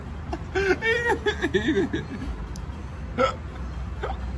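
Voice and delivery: high wheezing laugh; laughing through the burn. Real, not acted. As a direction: laugh like it hurts. Laughing from the spice.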